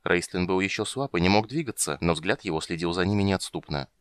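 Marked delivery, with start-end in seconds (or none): none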